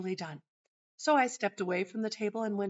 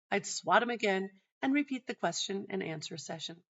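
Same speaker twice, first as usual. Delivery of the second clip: ordinary delivery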